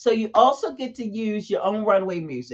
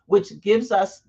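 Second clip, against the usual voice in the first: ordinary talk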